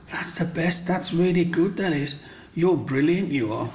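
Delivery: a hint of impressed schoolkids; kids' praise. Kids admiring the carving, lightly voiced praise. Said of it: "they would say".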